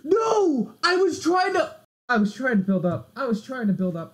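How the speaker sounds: gasp, then high anguished shout, easing off; very high, loud cry of dismay; horrified outburst. Real, not acted. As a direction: wail loudly at your own blunder, then settle. Own blunder in the game.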